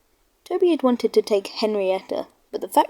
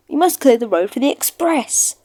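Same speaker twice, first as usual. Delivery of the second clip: louder, brisk official; brisk signalman; 4 semitones higher, 4 dB louder than usual. Acted. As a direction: order the engine on its way. Signalman sends Toby away.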